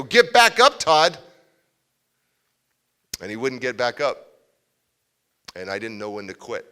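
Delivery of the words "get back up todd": loud, high shouted order; retold yell. A kid yelling at crying Todd to get back up, loud high shout. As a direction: yell it right down at him.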